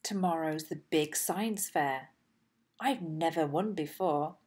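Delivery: ordinary delivery, nothing marked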